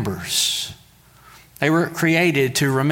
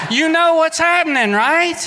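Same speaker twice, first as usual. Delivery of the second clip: much higher, laughing and louder; laughing tease; 13 semitones higher, 4 dB louder than usual. Real, not acted. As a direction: tease them through a laugh. Laughing tease about Hallmark plot.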